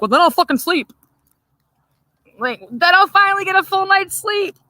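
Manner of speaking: sarcastic comeback. Retold or imitated. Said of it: "I'm like".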